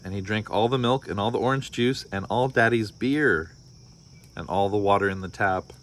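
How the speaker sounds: storybook read-aloud with voiced characters; story narration. A narrator's reading. Parent reading a picture book to listeners, lively storytelling. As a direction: read it like bedtime story time.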